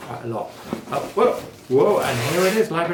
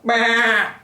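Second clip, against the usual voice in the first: high, loud sheep bleat; sheep baa; 9 semitones higher, 6 dB louder than usual. A narrator's reading. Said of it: "said the sheep".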